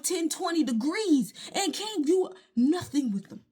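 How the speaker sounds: ordinary talk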